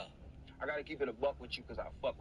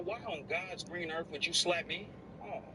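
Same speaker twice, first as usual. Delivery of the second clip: ordinary talk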